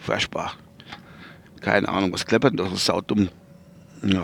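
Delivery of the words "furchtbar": breathy whispered aside. Real, not acted. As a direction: whisper it to yourself. Sighed aside.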